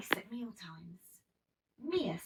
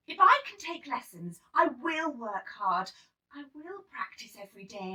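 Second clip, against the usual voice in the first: eager exclaiming; 4 semitones higher, 7 dB louder than usual. Acted. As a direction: promise it with all your heart. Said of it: "I exclaimed".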